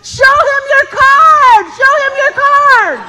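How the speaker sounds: very high, loud, drawn-out holler; mocking shout. Rancher to official chased by a bull, gleeful mocking shout. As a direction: holler it across a field, stretching the words.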